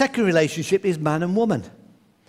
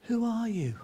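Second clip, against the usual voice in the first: softer repeat, like the valley answering; the echo answers the boy; 4 semitones higher, 8 dB quieter than usual. Retold or imitated. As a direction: let it come back faint. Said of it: "you could hear an echo".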